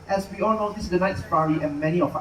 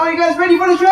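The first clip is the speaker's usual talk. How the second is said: louder hype call; 8 semitones higher, 10 dB louder, faster than usual. Shown as a demonstration. Host asking the crowd again, big hype shout. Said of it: "One more time".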